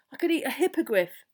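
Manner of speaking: exasperated, hungry whinge; hungry moan. A narrator's reading. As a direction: moan loudly about being starving. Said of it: "Ron moaned beside Harry".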